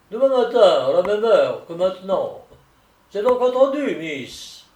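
high, loud, theatrical solicitor; eager solicitor. Acted. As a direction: seal the deal emphatically, dropping on "miss". Solicitor gladly seals the deal. (solliciteur empressé, voix haute et forte)

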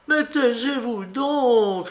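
she's losing her temper, slowly; growing anger. A narrator's reading. Maid snapping at her mistress, with growing anger. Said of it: "avec l'accent d'une colère grandissante".

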